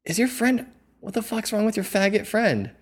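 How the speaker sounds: retold confrontational demand, pitched high; angry yelling. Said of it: "Why is she so angry?".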